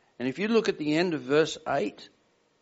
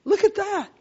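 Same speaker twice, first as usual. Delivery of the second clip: high-pitched, louder burst of fatherly delight; delighted exclamation; 13 semitones higher, 5 dB louder than usual. Retold or imitated. Father retelling his thought on seeing his son copy him, high and delighted. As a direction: marvel aloud in a much higher voice.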